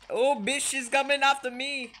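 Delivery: high, loud panicked cry; frightened yell. Real, not acted. Player yelling that the enemy is coming after her, frightened. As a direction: shout it as she closes in.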